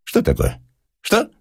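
impatient, baffled questioning; irritated. A narrator's reading. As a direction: fire back with mild annoyance. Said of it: "раздражился Персиков". (раздражённо)

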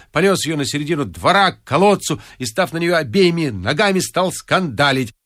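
ordinary delivery, nothing marked

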